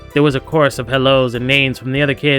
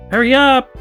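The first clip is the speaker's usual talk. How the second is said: high, loud call to hurry; impatient high yell; 12 semitones higher, 6 dB louder than usual. Acted. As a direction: holler to the stragglers. Lizzie calls to lagging kids.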